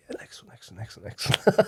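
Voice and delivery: hushed whisper, next one, next one; whispered brush-off. Retold or imitated. Musician whispering off a pushy stag guest's request. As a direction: whisper it aside as if waving him off. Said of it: "I was like".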